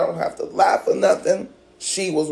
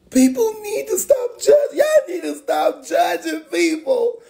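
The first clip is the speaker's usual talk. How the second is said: real shout; 7 semitones higher than usual. Real, not acted. To viewers who mocked him, a real shout. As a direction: yell it at everyone who judges.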